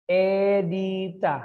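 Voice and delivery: very slow, each syllable held. Shown as a demonstration. Teacher repeats the name for counting, syllable by syllable. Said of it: "how many syllables".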